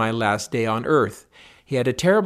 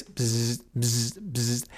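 lightly mimicked buzzer, fading softer; imitated elevator buzz; 6 dB quieter, much slower than usual. Telling friends about the elevator buzzer, soft mimicked buzz. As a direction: buzz like the call button. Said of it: "we kept hearing this".

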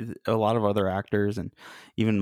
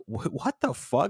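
mock shock; 5 semitones higher than usual. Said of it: "it's not like".

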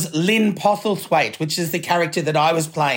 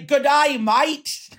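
higher, playful Aussie greeting; Australian greeting; 6 semitones higher, much slower than usual. Pretend. Australian guest greeting the host, hammed-up Aussie accent. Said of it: "I should say".

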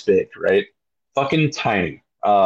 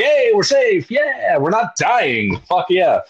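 much higher jubilant cheer; elated; 10 semitones higher, slower than usual. Retold or imitated. Rower safe on deck, high jubilant cheer. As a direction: celebrate surviving. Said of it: "I'm like".

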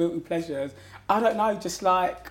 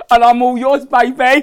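higher, louder, laughing through the line; laughing flirt; 7 semitones higher, 14 dB louder than usual. Real, not acted. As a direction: flirt while laughing at yourself. Flirty joke to his date.

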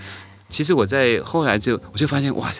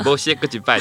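higher, louder mock scold from the kitchen; grandma waving off the violin practice, higher and louder; mother-in-law's dismissive scold; 6 semitones higher, 4 dB louder than usual. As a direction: bark it like a busy grandma at the stove. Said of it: "她说".

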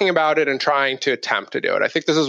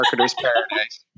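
laughing. Real, not acted. Ironic joke with laugh.

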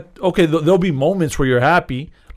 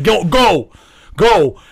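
urgent urging; 3 semitones higher, 3 dB louder, much slower than usual. Retold or imitated. Retold father urging kid to toilet.

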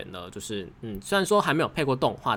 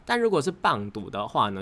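usual speaking voice

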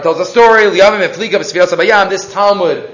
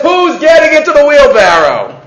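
showman's challenge; 8 semitones higher, 5 dB louder than usual. Retold or imitated.

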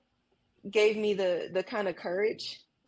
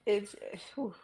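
holding back tears; 3 dB quieter than usual. Real, not acted. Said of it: "I'm trying not to cry".

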